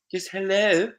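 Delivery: high, prim telephone voice; posh phone voice. Retold or imitated. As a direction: answer in a fake polite office voice. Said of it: "it's work-related or anything".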